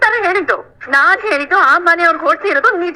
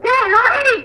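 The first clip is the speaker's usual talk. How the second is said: high, sharp yell of a name; angry yell; 8 semitones higher than usual. Acted. Family member yells at Rohini mid-quarrel, angry high shout.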